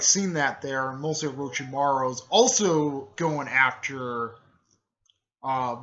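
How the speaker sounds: plain reading voice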